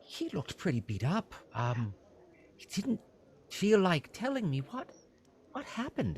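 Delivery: high, eager innkeeper voice; fussy innkeeper. Acted. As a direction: play a flustered, helpful innkeeper pitched high. Innkeeper talks of Sildar.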